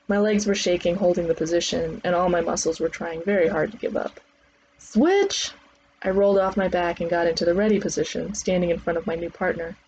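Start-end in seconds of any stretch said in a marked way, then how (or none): 4.8-5.5 high called-out command; trainer's call. Acted. Trainer switching partners mid-drill, high sharp call. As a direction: call the change across the gym.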